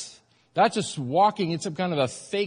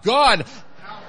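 louder and higher, really wanting help; urgent cry for help; 6 semitones higher, 5 dB louder than usual. Shown as a demonstration. Preacher leading congregation, urgent loud cry. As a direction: cry out like you mean it. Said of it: "say it like you really want his help".

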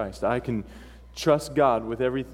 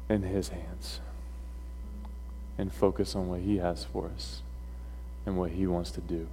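solemn sermon closing; 5 semitones lower, 6 dB quieter than usual. Real, not acted. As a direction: bring the message home softly, with long pauses. Preacher's solemn closing exhortation.

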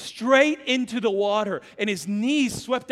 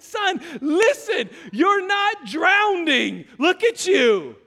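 loud, slow, pleading through laughter; laughing exasperated dad; 8 semitones higher, 6 dB louder, slower than usual. Retold or imitated. Dad telling his son he isn't drowning, laughing. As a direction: reason with him while cracking up. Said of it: "And I said".

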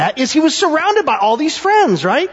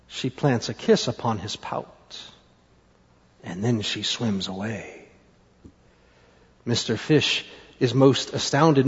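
low, soft narration with long pauses; hushed storytelling; 13 semitones lower, 8 dB quieter than usual. A narrator's reading. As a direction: hold the moment softly.

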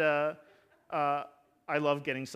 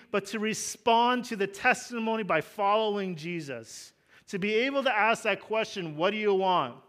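loud, higher pastor's call over the gospel; fervent preaching; 4 semitones higher, 6 dB louder than usual. Acted. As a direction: proclaim it with full conviction. Preaching at full force.

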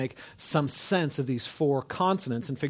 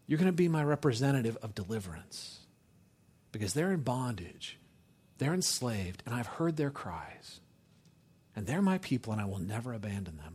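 soft, lowered, earnest promise; God; 4 semitones lower, 7 dB quieter than usual. Retold or imitated. Preacher voicing God about his enslaved people, softly earnest. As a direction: drop the volume and pledge it gravely. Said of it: "It's God basically saying".

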